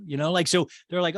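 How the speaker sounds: ordinary talk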